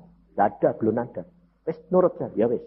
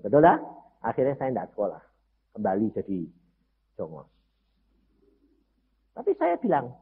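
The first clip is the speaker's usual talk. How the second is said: ordinary talk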